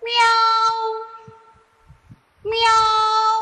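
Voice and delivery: high meowing cat sound; playful high cat call. Shown as a demonstration. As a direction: meow like a kitten for the class. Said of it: "I will make a sound of an animal".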